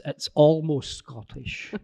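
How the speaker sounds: slightly hammed Ulster Scots, stretched out; Ulster Scots accent. Shown as a demonstration. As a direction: lean into a slow Ulster Scots lilt. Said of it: "give us a wee hint".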